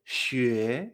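"xue" sliding upward; drawn-out rising "xue". Shown as a demonstration. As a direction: lift "xue" like a question.

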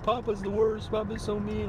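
high-pitched mock tattling kid; upset child imitation. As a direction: imitate a child complaining about a parent. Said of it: "He goes to my wife, he's like".

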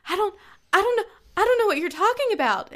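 high, quick, tearful denial; teary girl. Retold or imitated. Caught schoolgirl denying to the mom, high and teary. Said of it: "her little eyes welled up with tears".

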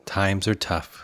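quiet, slow, weary mock line; imitating a downbeat, world-weary sigh; sighing resignation. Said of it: "somebody sighs and just says".

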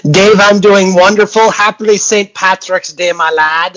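loud, badly faked Irish greeting; horrible Irish accent. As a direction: boom it out in a hammy mock-Irish accent. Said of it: "A horrible Irish accent there".

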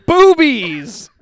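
loud, high punchline shout. Real, not acted. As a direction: belt the punchline out.

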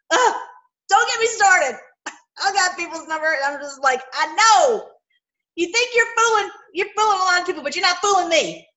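high loud indignant call-out; indignant shout. Acted. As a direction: tell the fakers you see through them. Preacher confronting the deceivers.

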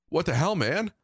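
shouting after him; calling after. A narrator's reading. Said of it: "I called after him".

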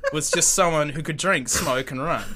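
normal talking voice

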